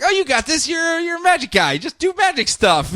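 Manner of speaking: high, breezy brush-off; breezy Kent. Retold or imitated. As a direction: cheer him off without helping. Kent dismissive encouragement.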